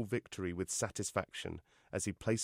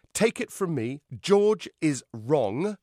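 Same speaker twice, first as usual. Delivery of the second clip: loud, raised, pouty argument; higher, emphatic sulky protest; pouting insistence; 6 semitones higher, 6 dB louder than usual. A narrator's reading. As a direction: insist petulantly that you know best. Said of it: "Echo pouted".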